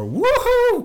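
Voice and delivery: loud, laughing cheer; excited woohoo. Acted. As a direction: whoop it up with a laugh. Cheering about going to Texas.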